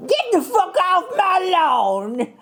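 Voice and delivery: high slow Southern granny voice. Pretend. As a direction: drawl it like a Southern grandma. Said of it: "That's Southern".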